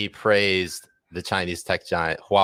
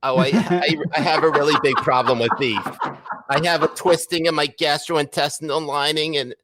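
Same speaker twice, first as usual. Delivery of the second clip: impressing Kyle's cousin, whiny and nasal; 6 semitones higher than usual. Retold or imitated. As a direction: whine it through the nose. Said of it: "in a whiny, nasally voice".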